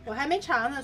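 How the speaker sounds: usual speaking voice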